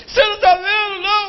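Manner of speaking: high, loud tearful shout; crying fan shouting. Retold or imitated. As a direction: yell it through tears. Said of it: "chorando e gritando". (fã gritando e chorando)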